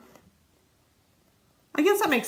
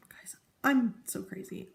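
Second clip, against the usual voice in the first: slow, soft whisper to herself; whispered aside; 5 dB quieter, slower than usual. Real, not acted. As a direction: whisper it, a little embarrassed. Self-deprecating whispered aside.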